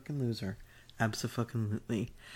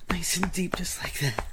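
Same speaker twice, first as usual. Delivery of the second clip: whispering; 8 semitones higher, 4 dB louder than usual. Acted. Whispered roleplay.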